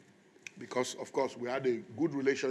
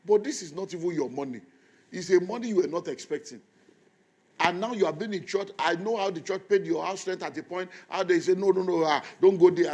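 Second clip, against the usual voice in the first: raised, higher scolding voice; scolding rebuke; 5 semitones higher, 8 dB louder than usual. Retold or imitated. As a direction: tell him off like a disappointed pastor. Pastor scolding friend in retold talk.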